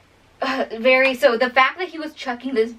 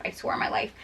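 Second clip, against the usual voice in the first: whispered, no voice at all; hushed unvoiced vow; 10 dB quieter, faster than usual. Shown as a demonstration. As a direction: breathe it as a secret oath.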